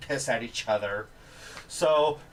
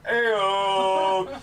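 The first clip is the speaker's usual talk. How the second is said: hint of a game-character call; lightly imitated higher call-out; Steve; 5 semitones higher, 4 dB louder than usual. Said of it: "Steve from Borderlands goes".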